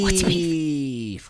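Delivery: slow drawn-out title call; segment title echo. Acted. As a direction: stretch out the show title.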